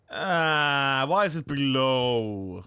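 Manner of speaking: slow, drawn-out whine sinking low; groaning complaint. Real, not acted. Gamer complaining about his ping, groaning complaint. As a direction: stretch the words into a groan.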